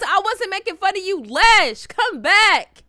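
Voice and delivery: high, loud theatrical plea; protest, then call them back; mock-pleading call. Begging an offended friend to return, high mock plea. Calling after friend who left.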